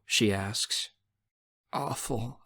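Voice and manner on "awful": choked up. A narrator's reading. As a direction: let it out quietly, a touch choked. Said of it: "My voice is choked up".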